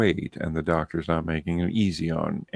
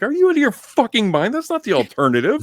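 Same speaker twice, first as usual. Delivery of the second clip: high, loud, incredulous shout; retold outraged yell; 7 dB louder, faster than usual. Father yelling at the doctor who scared his daughter, high loud outrage. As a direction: blow up in disbelief at her.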